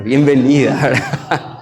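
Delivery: laughing ironic welcome from the pastor's wife; laughing mock welcome. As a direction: chuckle through a mock greeting. Pastor jokes about wife's welcome.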